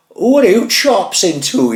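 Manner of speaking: high, slow, loud mock challenge; mocking the driver. Neighbour imitating the driver's cheeky parting shot, high and mocking. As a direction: sneer it back as the cheeky driver. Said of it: "You were like that to me".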